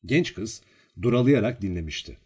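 ordinary delivery

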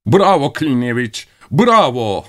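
high, rousing bravo; cheering. A narrator's reading. Crowd of the dead applauding Klinevich, exultant.

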